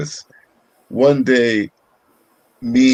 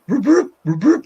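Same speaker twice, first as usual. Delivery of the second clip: siren imitation; 12 semitones higher, 3 dB louder than usual. Imitating police siren again.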